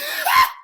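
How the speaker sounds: high, loud cackling laugh; loud burst of laughter. Real, not acted. Cracking up while telling viewers about one episode, high loud laugh. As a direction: crack up loudly, pitch shooting up.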